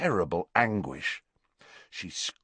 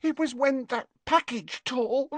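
high, tearful catch in the voice; sobbing. A narrator's reading. Leanne explaining to Hermione, sobbing. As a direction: answer through sobs. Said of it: "sobbed Leanne".

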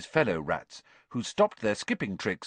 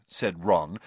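usual speaking voice

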